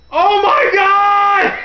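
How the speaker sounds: very high, loud, drawn-out shriek; hammed-up horror-movie scream, stretched out; horrified mock scream. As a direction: imitate horrified kids screaming. Kids witness apparent murder, retold.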